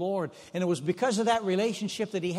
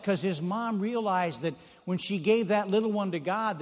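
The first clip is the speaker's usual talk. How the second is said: ordinary delivery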